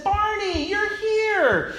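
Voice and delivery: put-on a little girl overjoyed to see Barney; excited little girl. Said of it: "hugged me, and said".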